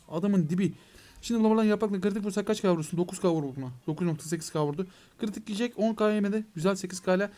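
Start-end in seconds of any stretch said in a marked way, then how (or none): none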